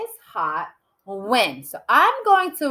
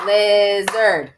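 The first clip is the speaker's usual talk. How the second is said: "Lizard" split into two beats; "Liz-erd", each beat stressed; clapped syllables; 4 dB louder than usual. Shown as a demonstration. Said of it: "Let's clap the syllables for lizard".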